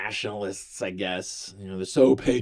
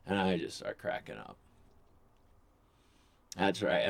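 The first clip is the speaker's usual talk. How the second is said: ordinary delivery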